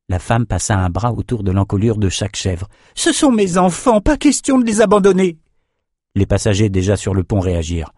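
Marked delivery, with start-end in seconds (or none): 2.9-5.4 high-pitched character voice, insistent; protesting old woman. A narrator's reading. Woman calling goats her children, stubborn protest. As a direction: refuse in a very high voice.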